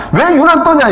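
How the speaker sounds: high-pitched mock complaint; annoyed scolding. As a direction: imitate a crowd fed up with noise. Acted annoyed crowd scolding the beggar. (짜증 내며 핀잔주는 흉내)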